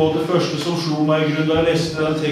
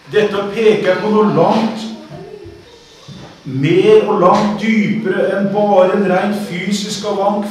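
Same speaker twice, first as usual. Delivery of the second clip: raised, forceful sermon voice; preaching; 8 semitones higher, 5 dB louder than usual. Real, not acted. Preacher expounding the story of old Eli and Samuel, forceful preaching. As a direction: preach it loudly, leaning hard on key words. Preaches on the text just read.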